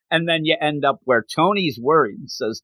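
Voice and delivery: ordinary talk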